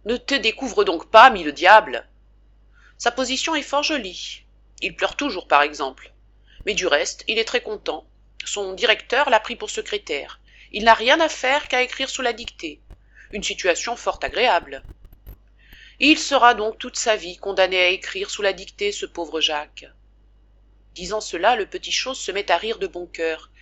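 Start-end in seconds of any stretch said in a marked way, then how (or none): 0.1-2.1 mock gruff scolding. Father loudly scolding his son for kicking off the blanket. 3.0-19.9 an excited, chattering father, a bit raised; the good man talking nonstop so the boy won't; lightly voiced father. Father at the bedside telling his sick son the whole story, eager and chatty.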